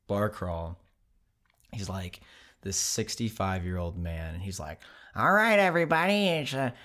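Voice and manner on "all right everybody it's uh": high, loud Wisconsin guide calling the group; rally the crowd in a thick Wisconsin accent; Wisconsin accent impression. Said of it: "a thick Wisconsin accent".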